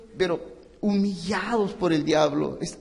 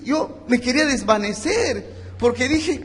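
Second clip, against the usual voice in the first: anguished confession; 6 semitones higher, 5 dB louder than usual. Retold or imitated. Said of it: "ella me dice".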